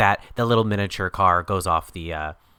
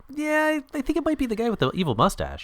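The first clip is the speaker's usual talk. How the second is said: mock naive. Mocking the obvious villain plot.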